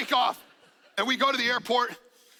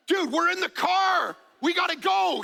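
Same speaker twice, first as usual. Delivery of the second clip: higher, louder urgent call; urgent shout; 7 semitones higher, 4 dB louder than usual. Retold or imitated. Dad hurrying his sleepy daughter, urgent shout.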